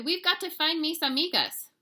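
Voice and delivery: high, loud and eager; urgent. A narrator's reading. Kitty boy urging his twin to find friends, eager and loud.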